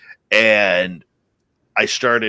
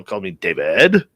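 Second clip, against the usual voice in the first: normal talking voice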